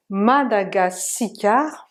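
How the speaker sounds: "Madagascar" slowly as ma-da-ga-si-kar; drawn-out "Madagascar" with five syllables; vowel between S-K. Shown as a demonstration. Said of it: "il y a une voyelle entre le S et le K".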